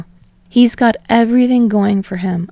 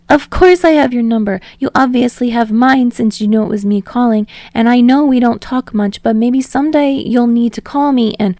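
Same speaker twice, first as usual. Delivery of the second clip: higher, louder eager Deku voice; rambling; 4 semitones higher, 4 dB louder than usual. Acted. Deku rambling to Bakugo on the phone, higher and eager. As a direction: babble on nervously without a breath. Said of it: "just starts rambling".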